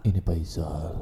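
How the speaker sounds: put-on very low, very soft old man; old Faisal voice. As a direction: croak it like a frail old man. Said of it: "Faisal tua mana?". (suara kakek tua yang berat dan lirih)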